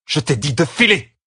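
high, angry command at someone's back; irritated order, sharpening at the end; hissed order. A narrator's reading. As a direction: snap it out with the pitch climbing. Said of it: "siffla Catherine dans son dos".

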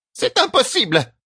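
very high-pitched cry of disbelief. A narrator's reading. Matriarch finding escape impossible, crying out. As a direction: cry out high without much volume. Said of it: "cria Beir".

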